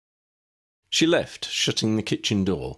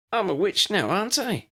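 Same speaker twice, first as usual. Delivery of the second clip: put-on much higher, gleeful girl's voice; crowing; 14 semitones higher than usual. Lydia boasts to her uncle, high and gleeful. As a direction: crow it triumphantly. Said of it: "Lydia crowed".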